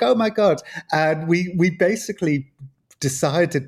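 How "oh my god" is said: a raised, amazed oh my God; retold surprise. Mild mock amazement. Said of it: "And he was like".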